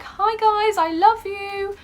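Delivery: a high, gushing fan greeting; generic gushing fan. Retold or imitated. Fan mocking the standard greeting, high gushing. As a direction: gush like every other fan. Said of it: "I can't go in there like".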